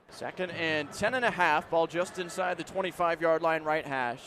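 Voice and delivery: ordinary delivery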